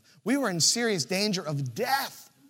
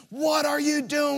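mock shout; 8 semitones higher, 4 dB louder than usual. [name] calling from the beach.

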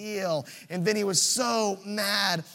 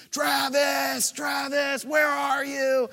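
retold frantic shout from shore; calling out; 7 semitones higher, slower than usual. Man yelling from the beach.

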